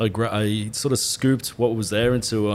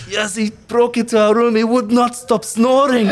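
higher-pitched mock Eastern European accent; 10 semitones higher, 3 dB louder than usual. Backpacker girl complaining about the snoring intruder, mock Eastern European accent. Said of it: "It's like Eastern European".

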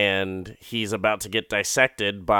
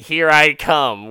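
slow, louder announcement; mock triumphant line; eager declaration; 5 semitones higher, 7 dB louder than usual. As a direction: declare it like a grand plan. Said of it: "she's just like".

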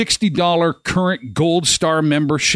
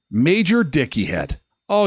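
ordinary talk, nothing marked

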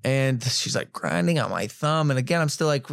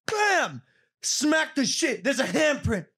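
high, drawn-out reenactment; worked-up exclamation; 11 semitones higher, slower than usual. Retold or imitated. Boxer reliving his hardest smack, worked-up. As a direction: act out the big hit in a high pitch. Acting out a full-force smack.

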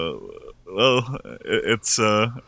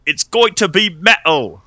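high, loud put-on character voice; Michael Scott; 9 semitones higher, 6 dB louder than usual. Host reading a movie review aloud in Michael Scott voice. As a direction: push it up high and loud, as a hammed-up impression. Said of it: "Michael Scott voice".

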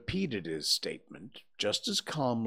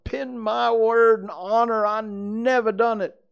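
high, loud anguished protest; sobbing plea; 13 semitones higher, 10 dB louder than usual. A narrator's reading. Accused Potter swearing innocence to friends, sobbing. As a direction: wail it, desperate to be believed. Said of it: "he sobbed".

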